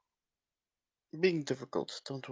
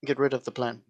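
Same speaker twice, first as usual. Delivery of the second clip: normal talking voice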